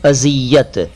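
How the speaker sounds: अजियत with a z sound; correct pronunciation. Shown as a demonstration. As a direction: model अजियत with z in place of j. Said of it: "ज sound करें". (अजियत में ज को ज़ की तरह बोलें)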